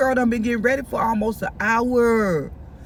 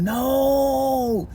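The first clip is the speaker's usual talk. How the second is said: long, held-out wail of disbelief; dismayed no. Retold or imitated. Storyteller opens the door to Sean, long dismayed no. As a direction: stretch the word out in acted dismay. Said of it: "the third time I said".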